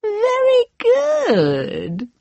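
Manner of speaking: high, very slow queen voice; evil queen. Acted. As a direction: gloat slowly like an evil queen. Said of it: "said the wicked queen".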